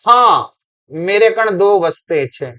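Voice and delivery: slow, drawn-out village Hindi answer; mock dialect reply, drawn out; mock rustic Hindi. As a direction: imitate a student's rural dialect, slowly. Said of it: "अब जवाब देने लगा है".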